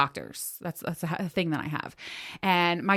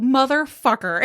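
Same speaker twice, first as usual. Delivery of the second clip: mock curse; 6 semitones higher, 4 dB louder, slower than usual. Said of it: "Like, I was like".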